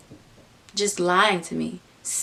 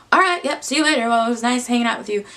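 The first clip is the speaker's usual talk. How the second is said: high, quickening mock goodbye; hasty farewell; 4 semitones higher, faster than usual. Guy hurrying out of her car, imitated hasty farewell. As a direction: rattle off a polite escape.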